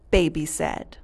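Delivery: ordinary delivery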